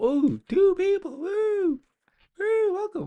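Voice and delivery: very high squeaky cheering voice; playful falsetto whooping; mock excitement. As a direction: squeal it in falsetto like a tiny crowd going wild. Mock cheering for two viewers.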